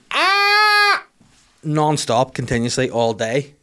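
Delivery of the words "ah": high, loud mock baby scream; baby wail. Comic imitating his baby's wail, high and loud. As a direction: shriek like a baby. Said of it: "the baby was just going".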